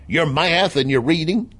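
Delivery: high, mocking Southern accent; Southern impersonation. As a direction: imitate a Southern voter scoffing. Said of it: "[name] continues his Southern impersonation".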